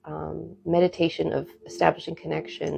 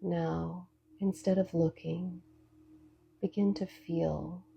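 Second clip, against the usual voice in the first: soft spa whisper; 11 dB quieter than usual. Acted. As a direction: lead them gently into calm. Leads a guided meditation.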